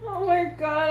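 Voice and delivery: ordinary talk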